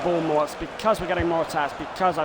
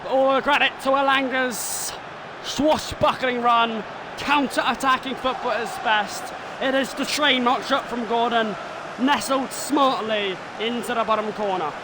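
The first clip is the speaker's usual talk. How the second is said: a bit higher, measured pundit read; commentary replay analysis; 5 semitones higher than usual. Acted. Gamer analysing his goal replay, commentator voice. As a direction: talk through the goal replay.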